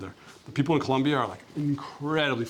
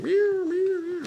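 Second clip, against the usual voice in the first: high squeaky meowing at the cat; mock cat meow; slower than usual. Camper meowing back at a cat on his bike, mock high meow. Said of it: "I'm like".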